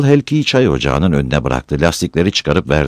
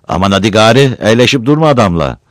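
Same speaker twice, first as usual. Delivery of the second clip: louder, higher testy scolding; fussy impatience; 5 semitones higher, 5 dB louder than usual. A narrator's reading. Old woman telling girl not to linger with him, testy. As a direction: hurry them along testily. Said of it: "Yaşlı kadın titizlenmişti".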